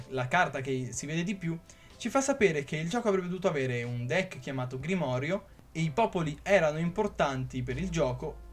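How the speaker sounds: ordinary talk, nothing marked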